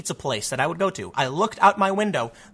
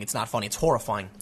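somber drop; 8 semitones lower, 6 dB quieter than usual. Real, not acted. Turning grave about shooting.

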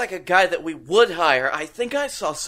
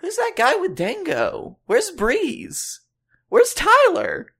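much higher, puzzled questions rising; imitating his own baffled self at the show; mock confusion; 11 semitones higher, slower than usual. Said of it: "I was like".

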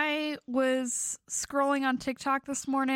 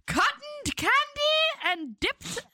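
higher hawker's call; stadium vendor hawking; 8 semitones higher than usual. Pretend. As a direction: call out across the stands. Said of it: "vendor calls".

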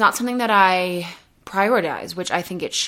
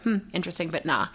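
dismissive; 3 semitones lower, 6 dB quieter, faster than usual. Pretend. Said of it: "observe that thought and be like".

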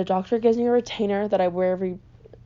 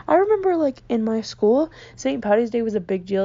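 usual speaking voice

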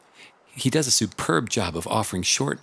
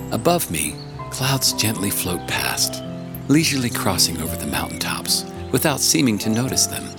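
audiobook narration. Acted. Reads audiobook chapter.

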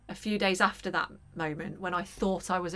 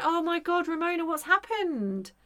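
mock-concerned grandma; 8 semitones higher than usual. Mum imitated seeing her bandaged granddaughter, high mock concern. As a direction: imitate a fussing, alarmed grandma.

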